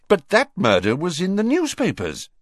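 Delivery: high-pitched surprised objection; puzzled, emphatic interjection; diverted from anger. A narrator's reading. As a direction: blurt it out, newly interested. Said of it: "momentarily diverted from his anger".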